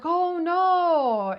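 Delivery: loud, high, drawn-out "oh no"; mock dismay. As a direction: exaggerate the guards' shocked sympathy. Security guards react to lost ring.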